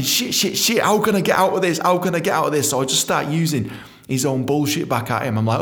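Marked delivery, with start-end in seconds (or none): 0.0-2.7 high frantic panic; panic, looking for a way out; high anxious repeated pleading; screaming inside. Retold or imitated. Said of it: "screaming inside thinking".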